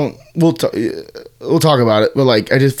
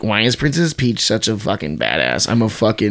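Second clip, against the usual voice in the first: mocked neckbeard; 5 semitones lower, 5 dB quieter than usual. Mocking haters of the Peach movie, neckbeard imitation. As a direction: lightly imitate a grumbling internet complainer.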